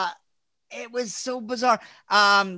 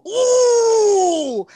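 sudden high yelp, louder; high pained yelp; 12 semitones higher, 6 dB louder than usual. Retold or imitated. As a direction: yelp as if jolted. Lawyer's cry as he's cursed.

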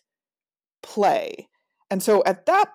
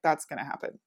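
usual speaking voice

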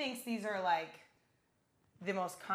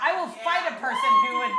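mock threat; 9 semitones higher, 11 dB louder than usual. Joking threat over Willy Wonka.

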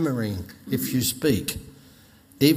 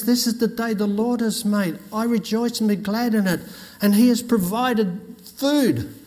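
higher, jubilant proclamation; joyful old lady; 7 semitones higher than usual. Retold or imitated. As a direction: announce it with delight. Old lady rejoicing over groceries.

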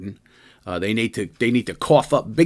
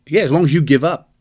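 lightly mocking negotiator, pitched up; raised, mock-bargaining delivery; mock Putin demand; 4 semitones higher, 5 dB louder than usual. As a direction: voice a tyrant's terms in a higher, louder mock tone. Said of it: "his negotiating point".

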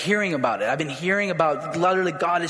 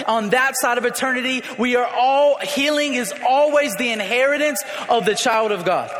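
preaching at full force; 4 semitones higher than usual. Acted. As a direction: preach it to the back row. Preaching that God always heals.

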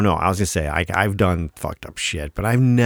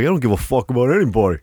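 quick, dismissive mock-American brashness; mock American attitude; 3 semitones higher, faster than usual. Co-host mocking the other's American attitude, brash and dismissive. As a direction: toss it off with put-on American attitude. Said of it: "that fucking American attitude right there".

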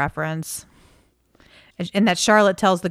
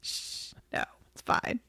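whisper; 14 dB quieter than usual. Real, not acted. Hushing partner mid joke.

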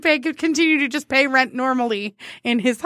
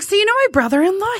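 quoted coworker; 7 semitones higher, 4 dB louder than usual. Retold or imitated. Coworker voiced again, high.

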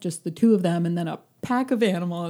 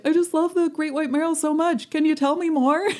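gushing fan; 9 semitones higher, 4 dB louder than usual. Retold or imitated. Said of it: "someone's asking her".

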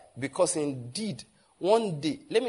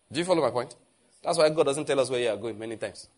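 normal talking voice

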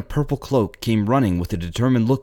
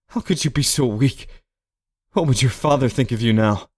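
higher, anguished self-reproach; exclaiming to himself; 8 semitones higher than usual. A narrator's reading. Man scolds himself over his weakness, anguished exclaiming. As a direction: berate yourself as a man of honor. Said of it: "he exclaimed to himself".

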